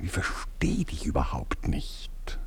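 low, slow, hushed bewilderment; whisper. Acted. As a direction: murmur your confusion in a whisper. (leises, verwirrtes Flüstern)

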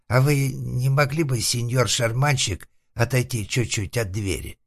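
high, polite, wary request; timid recluse. Acted. Затворник вежливо и робко просит. (робкая высокая просьба)

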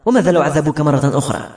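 plain reading voice

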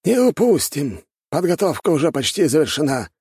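high, emphatic elder voice; assertive old master. A narrator's reading.

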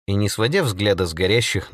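usual speaking voice

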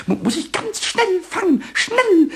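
high, rising eagerness; urgent excitement. Acted. Excited urge to catch the mouse. (aufgeregt und drängend)

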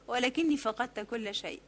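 ordinary delivery, nothing marked

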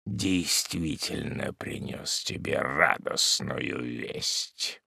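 low, slow, muffled hermit; hollow voice. A narrator's reading. Hermit tells the widow grim news, hollow and low. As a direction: sink the voice into a dark hush. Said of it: "глухим голосом".

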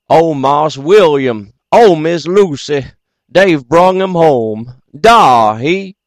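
loud, slow, high bawl with a put-on voice. A narrator's reading. Old servant calling to the house, bawling out loud. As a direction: holler the news toward the house. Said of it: "bawled out".

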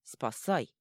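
growled troll command. A narrator's reading. Troll demanding the god save her, growling. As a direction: growl the order. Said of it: "прорычал он".

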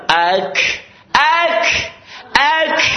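"ak" ending in a puff of air on the k; hams drill. Shown as a demonstration. Teacher drills hams, "ak" with an aspirated k. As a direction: release the k of "ak" with an audible rush of breath.

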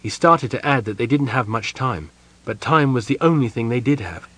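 plain reading voice

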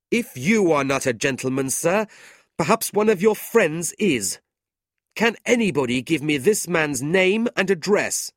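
high, loud, ringing demand; indignant challenge. A narrator's reading. Brother demanding a name from the table, indignant.

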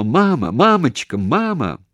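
high, loud cries for mama; desperate calling. A narrator's reading. Daughter losing mother on the phone, desperate calling. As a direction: call out as the line dies.

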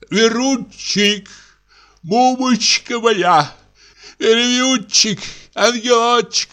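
slow, high, coaxing endearments. Acted. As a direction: sweet-talk her out of bed, slowly. Husband coaxing wife through window. (ласковое уговаривание, медленно)

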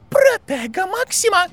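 high-pitched hoarse battle cry; strong hoarse shout. A narrator's reading. Bellatrix casting a shield against Aurors' fire, hoarse high shout. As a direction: cry out the spell with a strong, rasping voice. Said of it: "выкрикнула Белатриса сильным хриплым голосом".

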